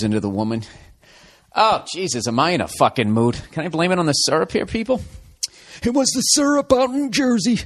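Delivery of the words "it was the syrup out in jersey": high, Jersey-tinged impression of Anthony. Blaming the syrup, high Anthony impression. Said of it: "That's Anthony's impression".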